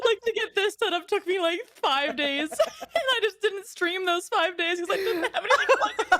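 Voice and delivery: high-pitched, talking through real giggles; laughing at oneself. Real, not acted. Streamer admitting a five-day setup struggle, laughing at oneself. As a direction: confess it while cracking up. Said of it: "I'm just laughing at myself".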